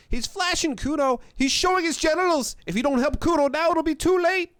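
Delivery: high, loud squealed accusations; yelling boy. A narrator's reading. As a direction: shout it to the whole yard in a kid's voice. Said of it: "yelling at the windows".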